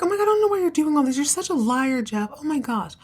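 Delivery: high, fast exasperated brush-off; scoffing Fallon. Retold or imitated. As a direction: roll your eyes and rattle it off way up high.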